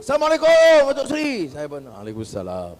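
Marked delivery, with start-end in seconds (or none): 0.0-1.5 high, loud hail from across the road; imitated shouted greeting. Youths greet the Datuk Seri across the road, shouting. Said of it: "dia jerit". 1.9-2.7 low, soft retold answer; subdued reply. Politician answers the BN-shirted youths' greeting, low and subdued.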